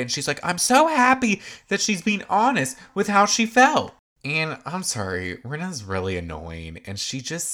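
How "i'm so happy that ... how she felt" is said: much higher and louder, gushing fake cheer; mock a forced, too-bright enthusiasm; fake over-excited. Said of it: "tries to act too excited".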